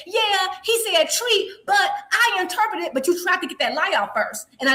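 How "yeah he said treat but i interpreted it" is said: slow, higher mock voice of the other guy; mocking his backpedal. Host parroting the co-host's excuse in a slow, higher mock voice. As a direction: parrot him back slowly and higher to make fun of him. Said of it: "then you backpedaled and said".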